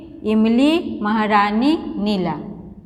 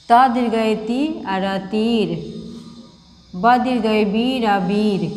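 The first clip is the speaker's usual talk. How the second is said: slow spelling out; slower than usual. Shown as a demonstration. Spelling out words for children.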